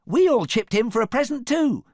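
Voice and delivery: high, loud piglet voice; squeaky eager cry; little pig crying out. A narrator's reading. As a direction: pipe up loudly and proudly. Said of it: "cried one of the little pigs".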